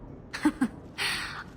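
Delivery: short unvoiced scoffing laugh; breathy bitter laugh. Recalling being a husband's plaything, a breathy bitter laugh. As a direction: huff a dry laugh.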